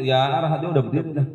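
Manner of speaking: normal talking voice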